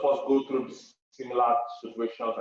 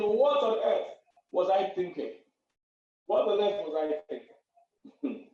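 mock regret; 8 semitones higher, slower than usual.

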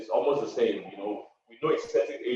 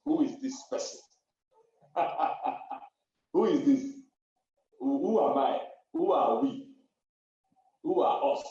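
mock bewilderment; 9 semitones higher than usual.

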